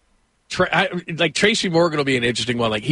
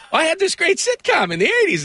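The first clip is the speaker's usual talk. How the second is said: high-pitched mock pleading; hammed-up celebrity plea, pitched way up; Seinfeld. Host mocks Seinfeld asking for tickets, high mock plea.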